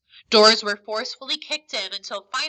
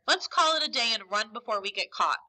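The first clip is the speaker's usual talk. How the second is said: usual speaking voice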